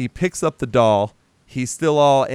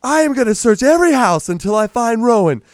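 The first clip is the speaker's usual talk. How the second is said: angry threat; 8 semitones higher, 5 dB louder than usual. Retold or imitated. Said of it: "And he's like".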